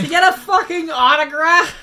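high, loud yell from next door; from another room. Acted. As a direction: bellow it through the wall. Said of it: "Eugene yells from the other room".

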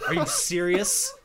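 loud, high, slowed disbelief through a laugh; incredulous. Retold or imitated. Witcher stuck at bank bureaucracy.

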